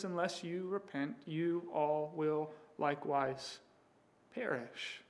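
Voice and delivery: Jesus quietly warning the outraged crowd; hushed warning. Acted. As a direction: drop to a near hush for the warning.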